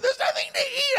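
high, loud, wailing wife; shrill wife voice. Retold or imitated. Preacher mimics wife crying over empty kitchen, high and wailing. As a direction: wail it like a desperate young wife.

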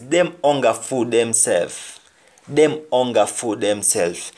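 Jamaican patois saying, repeated; patois proverb demo. Shown as a demonstration. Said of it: "They are only fooling themselves".

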